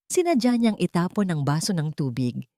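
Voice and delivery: plain reading voice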